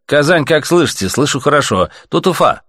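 high, projected call signs; radio operators calling. A narrator's reading. Cities calling each other on air, radio hailing. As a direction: hail distant stations one after another.

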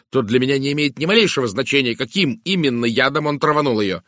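combative woman character. Acted. Woman defending her accusation to the detective, combative. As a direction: argue back heatedly, refusing to budge. Narrator voices indignant Miss Howard.

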